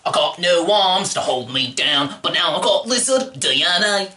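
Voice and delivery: a hint of an Aussie Lizard; Australian Lizard. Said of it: "actors playing Americans are now Australian".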